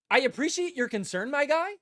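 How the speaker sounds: mocking Nico's polite dodge; mocking repeat. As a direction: ridicule the line. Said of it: "Say something".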